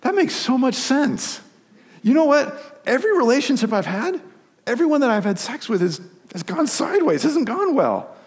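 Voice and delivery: high-pitched voice of a man suddenly seeing it; amazed young man. Retold or imitated. Young man's answer over dinner.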